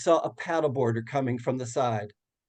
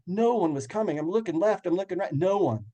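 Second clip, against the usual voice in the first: ordinary talk, nothing marked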